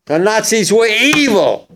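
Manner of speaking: rising to a high, loud, furious shout on evil; Ike's outraged order. Retold or imitated. General rallying his troops, building to a high furious shout. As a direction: bellow it like a general who has seen the camps.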